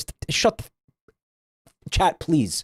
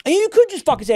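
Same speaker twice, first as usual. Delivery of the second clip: exasperated outburst; 13 semitones higher, faster than usual. Real, not acted. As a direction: blurt it out, exasperated at the euphemisms. Exasperated advice to viewers.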